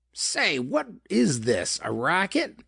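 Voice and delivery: a tough prisoner's sneer, drawn out; snarling. A narrator's reading. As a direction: spit the question out with contempt. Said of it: "the prisoner snarled".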